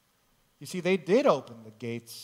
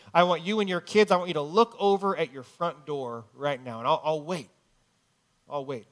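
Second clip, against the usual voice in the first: urgent, raised preacher plea; pleading preacher altar call; 3 semitones higher, 5 dB louder than usual. Acted. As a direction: urge the congregation up and out. Altar call urging viewers to move.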